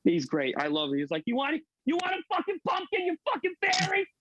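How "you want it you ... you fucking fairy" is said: put-on much higher, ranting comedian voice in a Boston accent; much higher mock rant in a Boston accent, comedian style; Bill Burr impression. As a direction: rant it like a Boston stand-up picking a fight. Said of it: "You sound just like him".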